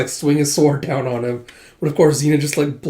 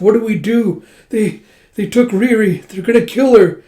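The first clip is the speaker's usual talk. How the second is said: high, loud, distraught plea; acted crying; 8 semitones higher, 6 dB louder than usual. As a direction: plead in panic as if in tears. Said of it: "then she starts crying".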